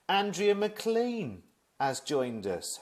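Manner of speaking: high and slow, savouring the name; excited announcement. Real, not acted. Announcing a famous friend joining the stream, excited and slow. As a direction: announce her like a special guest.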